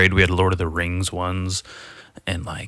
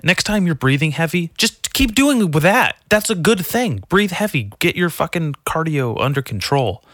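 hyped coaching; 8 semitones higher than usual. Acted. Podcaster hyping listeners to breathe heavy, pep-talk. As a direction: pump them up like a gym coach.

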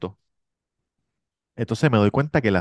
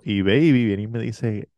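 ordinary talk

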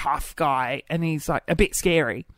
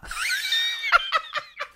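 breathy burst of laughter; helpless giggling laugh; 4 dB quieter than usual. Real, not acted. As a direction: crack up at your own joke. Laughing at own joke name.

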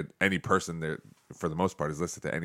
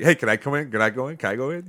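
higher, lightly imitated eager kids; a hint of clamoring children, pitched up; pestering kids like seagulls; 7 semitones higher, 5 dB louder, faster than usual. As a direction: mimic kids begging to be let in. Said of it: "like the seagulls in that".

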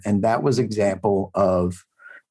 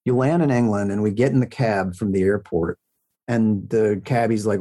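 ordinary talk, nothing marked

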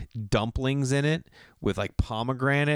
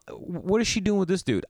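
incredulous; 8 semitones higher than usual. Retold or imitated. Jealous inner disbelief.

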